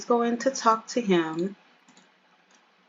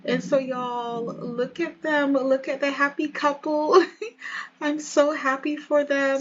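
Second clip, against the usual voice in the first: high-pitched, delighted cooing; happy gushing; 6 semitones higher than usual. Real, not acted. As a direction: coo over the happy couple. Narrator gushing over happy couple.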